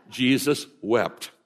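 slow, deliberate "Jesus wept"; memory verse. Shown as a demonstration. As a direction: give "Jesus wept" slowly, word by word, to be memorised. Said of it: "here's our memory verse this week".

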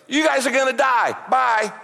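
mock-blithe warning and quick sign-off; uncaring Jonah. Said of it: "He doesn't care two bits".

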